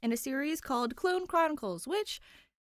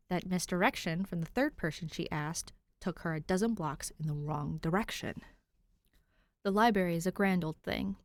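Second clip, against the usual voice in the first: calm, even storybook reading; story narration; 7 semitones lower than usual. Acted. Host reading the fanfic to viewers, calm narration. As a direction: read the tale steadily to listeners.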